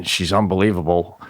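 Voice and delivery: ordinary talk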